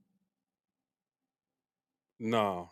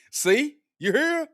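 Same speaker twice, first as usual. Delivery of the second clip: excited laughing; 8 semitones higher, 9 dB louder, slower than usual. Real, not acted. Laughing at the chat's comment.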